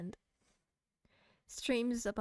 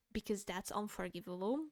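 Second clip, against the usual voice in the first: ordinary talk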